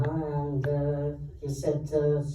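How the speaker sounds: normal talking voice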